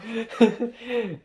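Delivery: a high burst of laughter, trailing down; real laugh. Real, not acted. Cracking up at own joke about cleaning her place: real laugh.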